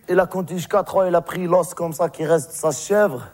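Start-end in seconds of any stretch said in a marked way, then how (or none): none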